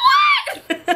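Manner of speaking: very high, shouted disbelief; shocked shriek. Retold or imitated. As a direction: shriek it like you just found out who he is. Learns he made the hit song.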